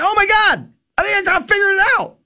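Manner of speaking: high, thrilled gasp of discovery; a beginner suddenly thrilled to be upright; excited realization. Retold or imitated. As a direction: sound like you just cracked it, quickly. First success on the hill.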